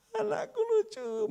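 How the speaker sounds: very high, soft cooing voice; doting cooing father. Retold or imitated. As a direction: coo at the child on a video call. Doting father on video call. (suara ayah yang sangat tinggi dan lembut, menimang anak)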